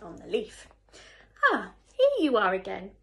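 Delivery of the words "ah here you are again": loud, delighted welcome; friendly greeting. A narrator's reading. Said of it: "said the friendly ladybird".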